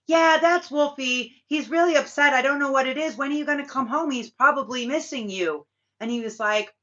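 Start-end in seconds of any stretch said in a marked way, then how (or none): 0.1-5.7 mock mom voice. Mom on phone in retelling.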